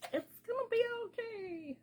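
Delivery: high, stretched-out self-reassurance. Real, not acted. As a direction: lift it way up and draw the words out. Reassuring herself that things work out.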